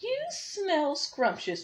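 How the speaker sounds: slow, high, drawn-out mooing words; the hungry cow, slow and mooing. Acted. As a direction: stretch it like a lowing cow. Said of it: "mooed the cow".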